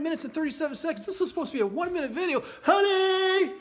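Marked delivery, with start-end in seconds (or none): 2.6-3.5 higher, loud call to someone in another room. Real, not acted. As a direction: call out to your wife. Calling to his wife.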